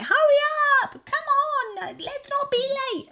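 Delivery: high, loud mock yell at the children; mother shouting at the children to hurry; shouting at kids. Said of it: "be shouting".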